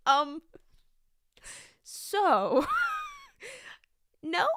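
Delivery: laughing through a flustered answer. Real, not acted. Teacher laughing as she retells answering the kid. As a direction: giggle out the awkward no.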